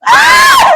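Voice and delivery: sharp startled scream; sudden scream. Real, not acted. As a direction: shriek in fright. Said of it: "napasigaw ako".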